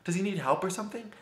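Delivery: panicking at the passed-out stranger; freaked out. Retold or imitated. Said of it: "And I freaked out".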